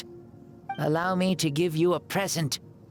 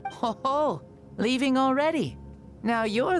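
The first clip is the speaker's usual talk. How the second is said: high, amused ribbing; teasing; 8 semitones higher than usual. Acted. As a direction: tease them lightly, pitched well up. Teases someone who is leaving.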